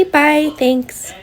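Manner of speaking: ordinary talk, nothing marked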